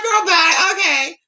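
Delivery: put-on high, drawn-out girl bye; sassy dismissal. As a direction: brush them off with sass. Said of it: "it's like".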